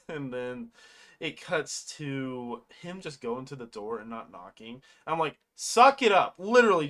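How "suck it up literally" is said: louder, higher, exasperated urging; impatient scolding. Retold or imitated. Viewer urging him to knock, impatient scolding. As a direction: scold a character through the screen.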